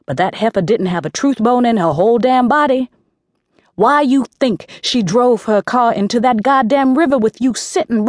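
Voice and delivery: a mild mock fury that climbs to the insults; harsh berating. A narrator's reading. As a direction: tear into the stupid girl. Aunt berates niece harshly.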